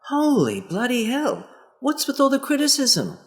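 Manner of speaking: hammed-up indignation at being criticised; exasperated outburst. Partner hit by repeated criticism, mock exasperated cry. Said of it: "they go".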